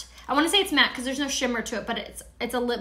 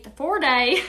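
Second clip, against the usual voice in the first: "four day" said the Southern way, D stretched into "day"; accidental Southern accent on "four day"; 4 dB louder than usual. Real, not acted. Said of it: "I don't know where the Southern accent comes from".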